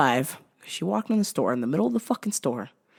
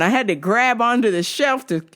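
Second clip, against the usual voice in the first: ordinary delivery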